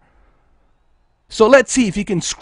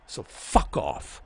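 slow, breathy whispered dismissal; 8 semitones lower, 10 dB quieter, much slower than usual. Real, not acted. Streamer hissing a slow whispered brush-off at his chat. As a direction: hush it out slow and cold.

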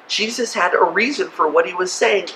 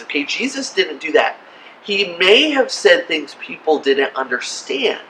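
ordinary talk

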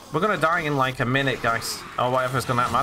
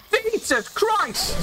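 real high-pitched, drawn-out scream of shock; startled scream; much slower than usual. Real, not acted. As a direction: scream it out at a sudden jump scare. Jump scare during the game.

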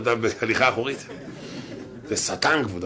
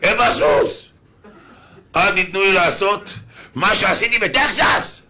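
high, loud shout with a long drawn-out opening call; acted outraged yelling, stretched for comic effect; 8 semitones higher, 6 dB louder, slower than usual. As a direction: bellow a hammed-up threat to the whole crowd. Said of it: "הוא התחיל לצעוק".

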